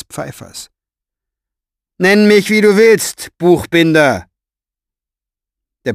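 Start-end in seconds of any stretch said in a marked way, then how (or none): 2.0-4.3 high, loud, pressed sneer; villain voice. Acted. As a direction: shout it down with contempt. Villain shouts down from battlements.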